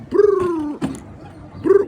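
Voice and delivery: high rolled brr to call birds; high bird call trill. Acted. Calling to pigeons.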